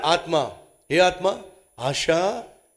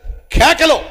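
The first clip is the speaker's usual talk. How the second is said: mock yell; 8 semitones higher, 7 dB louder, much slower than usual. Preacher acts out the demoniac's cries.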